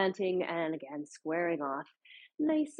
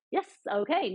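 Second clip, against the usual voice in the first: ordinary delivery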